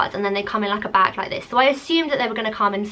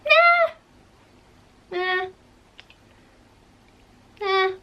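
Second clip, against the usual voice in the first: incredulous nah; 10 semitones higher, much slower than usual. Real, not acted. Comparing the wrong flowers in disbelief.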